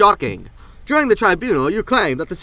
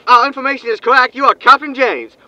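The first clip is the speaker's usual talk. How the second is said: higher, louder newsman patter over the comm; eager reporter hail; 7 semitones higher, 5 dB louder than usual. Acted. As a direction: greet him like a pushy TV reporter. Reporter hails over ship comms.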